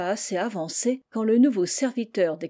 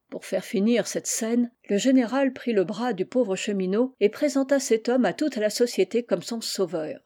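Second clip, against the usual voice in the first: usual speaking voice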